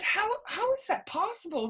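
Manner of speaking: high-pitched retold disbelief; incredulous question. New teacher questioning her aide about non-English speakers, incredulous. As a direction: ask it baffled, pitch leaping up.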